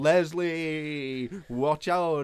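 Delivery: drawn-out, echoey teasing warning; mock echoing call. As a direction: call out as if echoing under an upturned boat. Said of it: "making an echo of his voice".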